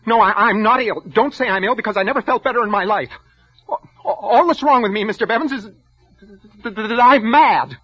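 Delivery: high, loud, tripping over the words; so angry the words catch; stammering fury. Acted. Clerk denies being ill to his boss, stammering with rage.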